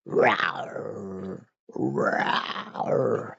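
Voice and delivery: breathy pretend rawr, mostly unvoiced; mock cat roar. Streamer growling for her cat at viewers, mock rawr.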